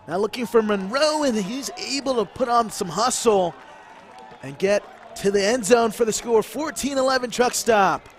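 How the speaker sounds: high, loud scoring call; excited play-by-play. Real, not acted. Commentator calls Monroe scoring for truck stop, excited play-by-play. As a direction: ride the play all the way into the end zone.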